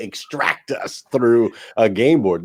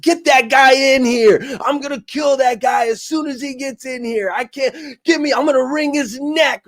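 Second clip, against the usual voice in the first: mock scream; 12 semitones higher than usual. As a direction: imitate a man screaming threats, pitched way up. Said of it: "he's screaming, like".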